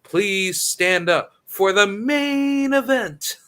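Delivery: slow, loud, high hype call; boxing ring announcer. Shown as a demonstration. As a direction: announce the main event to the arena. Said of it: "his voice doesn't sound like a boxing ring announcer".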